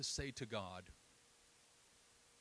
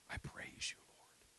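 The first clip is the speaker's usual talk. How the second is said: breathy whispered prayer through grief; retold grieving whisper to God; whispered praise; 5 dB quieter, slower than usual. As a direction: whisper it as if tears choke it. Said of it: "began to weep and wail".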